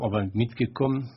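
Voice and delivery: ordinary talk, nothing marked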